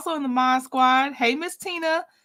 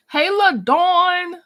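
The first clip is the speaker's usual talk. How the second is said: slow, louder, higher sing-song hello; stretched greeting; 4 semitones higher, 5 dB louder, much slower than usual. Real, not acted. Welcoming a viewer in the chat, drawn-out happy hello. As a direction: stretch her name out with delight.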